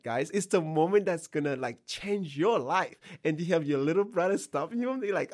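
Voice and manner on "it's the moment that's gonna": ordinary delivery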